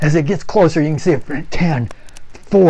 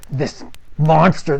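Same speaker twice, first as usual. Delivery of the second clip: ordinary delivery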